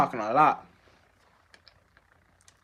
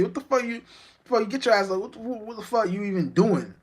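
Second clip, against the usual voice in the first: outraged yell; 8 semitones higher than usual. Acted. Continued outraged God voice.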